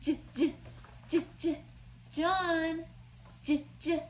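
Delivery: "J" said as a repeated j sound. Shown as a demonstration. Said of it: "what sound the letter J makes".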